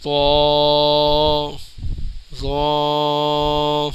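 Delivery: تو and زو with long rounded vowels; drawn-out letters. Shown as a demonstration. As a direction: hold تو, pause, hold زو level. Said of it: "ہلکا سا کھینچ کے پڑھیں گے".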